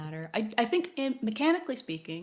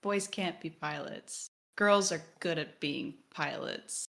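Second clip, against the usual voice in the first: matter-of-fact statement; 2 semitones lower than usual. Retold or imitated. Characters asserting a rule nobody explains, matter-of-fact. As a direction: state it as settled fact, unhurried. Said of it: "They just say it like a statement".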